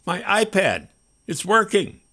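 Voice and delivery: bursting with excitement; excited. A narrator's reading. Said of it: "he said in excitement".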